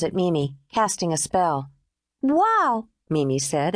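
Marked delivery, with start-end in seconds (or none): 2.2-2.9 put-on high awed child wow; admiring little girl. A narrator's reading. Mimi admires the painted nails, high and awed. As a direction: breathe out in wonder. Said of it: "admiring the artwork".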